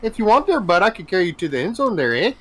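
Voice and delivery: high, fast, folksy Canadian voice; mock Canadian. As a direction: rattle it off as a cheery Canadian. Linebacker offers to carry quarterback.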